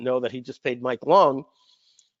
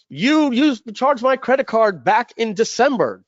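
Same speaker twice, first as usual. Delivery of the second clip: higher-pitched mock complaint from a customer; irate customer; 7 semitones higher than usual. Customer accusing seller of old charge, mock irate and higher. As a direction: play the annoyed customer accusing you, a touch hammed-up.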